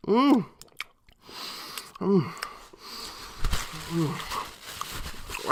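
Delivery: ordinary delivery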